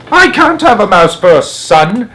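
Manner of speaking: high, loud shriek; father shrieking in horror. Acted. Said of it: "shrieked Mister Jenkins".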